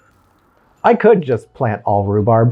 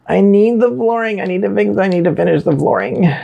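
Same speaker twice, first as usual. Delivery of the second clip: mock urgency; 9 semitones higher than usual. Singsong reminder to self.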